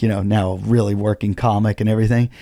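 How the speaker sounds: usual speaking voice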